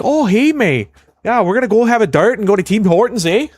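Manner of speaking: high, exaggerated Ontario Canadian accent. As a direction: ham up a Canadian hoser accent. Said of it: "Canadian accent".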